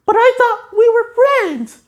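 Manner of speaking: plead, wounded; hurt SpongeBob. Retold or imitated. SpongeBob hurt by his spatula, high and pleading.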